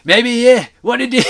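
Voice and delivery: high, loud mock Scottish old man; bad Scottish accent. Reviewer mocking the old man's lines, in a bad Scottish accent. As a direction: squawk it out in a terrible Scottish accent. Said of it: "And the old man, he's like".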